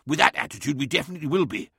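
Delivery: mild snap. A narrator's reading. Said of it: "snapped Lemuel, his patience wearing thin".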